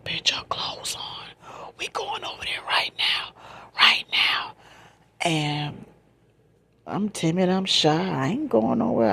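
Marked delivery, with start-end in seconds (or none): 0.0-4.6 forceful, voiceless whisper; insistent hushed command in imitation; retold urgent order. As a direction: whisper the command, pushing hard on the last words. Retells cousin's urgent order.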